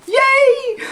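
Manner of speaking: high, squealed yay of real joy; thrilled little cheer; delighted yay. Real, not acted. Opening a surprise present.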